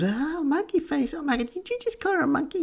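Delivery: very high falsetto imitation of outrage; high-pitched pearl-clutching mimicry; mock shocked objector. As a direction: gasp it out in a squeaky, scandalised voice.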